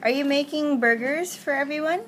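ordinary talk

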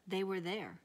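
ordinary delivery

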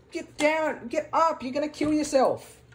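high, loud urgent warning; alarmed shout. Real, not acted. Sister warning her brother he'll kill himself, loud alarmed shout. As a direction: yell it out in alarm. Warning player about dangerous jump.

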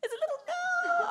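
high, shrieking delight over the news; mock gushing squeal. Comic host squeals at a couple's baby news, hammed-up. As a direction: overdo the joy at the baby news.

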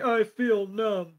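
high, very slow dazed voice; mock numb daze; numb Brock. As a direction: drag it out dazed. Brock stung by poison.

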